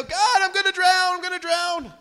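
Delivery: high, loud panicked yell; mock yell. Believers yelling to God in the storm, loud and panicked. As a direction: cry out as if going under. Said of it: "we yell".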